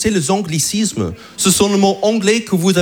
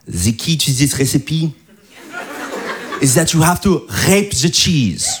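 put-on French accent; 5 semitones lower than usual. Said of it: "Elle m'a dit".

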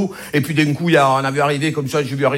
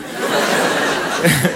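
laughing. Real, not acted. Audience-like laugh.

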